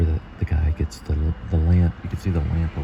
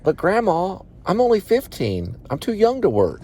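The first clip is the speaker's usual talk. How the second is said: put-on whiny kid voice; 13 semitones higher than usual. Kid self answering grandma.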